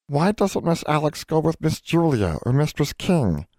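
high, squeaky elf voice; pleading house elf. Acted. House elf asking why Alex won't take Julia. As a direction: ask it like a worried little elf.

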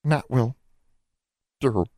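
high little elf voice, halting; tiny reluctant voice. A narrator's reading. Nat gives in to Alexandra, small halting voice. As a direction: squeeze the words out with a long pause. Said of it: "so quietly that Alexandra had to lean forward".

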